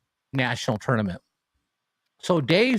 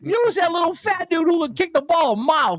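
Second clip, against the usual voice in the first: much higher, gushing mock stranger voice; amazed stranger; 12 semitones higher than usual. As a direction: rave up high like a starstruck fan.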